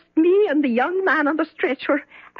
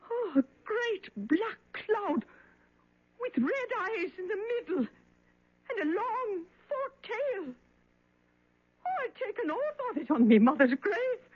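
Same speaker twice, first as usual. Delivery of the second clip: high, much softer, near tears; frightened hush; 6 semitones higher, 12 dB quieter than usual. Acted. Cleaning woman tells the doctor of the devil, in a frightened hush. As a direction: whisper the horror you saw. Witness recalls seeing the devil.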